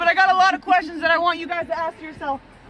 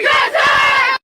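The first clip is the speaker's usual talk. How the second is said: hype shout; 7 semitones higher, 6 dB louder than usual. Pretend. Team answers the huddle.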